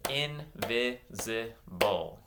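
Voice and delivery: INVISIBLE slowly, a gap after every syllable. Shown as a demonstration. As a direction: clap out "Invisible" piece by piece. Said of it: "clap out some syllables".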